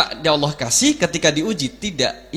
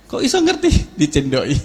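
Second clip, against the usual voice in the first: laughing through a joking question; amused laughing question; 2 semitones lower, 4 dB louder than usual. Real, not acted. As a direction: chuckle while asking. Laughs at own joke.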